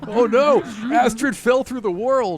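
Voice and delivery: alarmed exclamation. Real, not acted. GM reacting as Astrid falls through the map, mock panic. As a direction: sound the alarm, half laughing.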